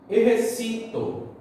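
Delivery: "exercito" as Spanish "eh-her-SI-to"; slow "exercito", leaning high on "ci"; stress on penultimate syllable. Shown as a demonstration. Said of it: "penúltima".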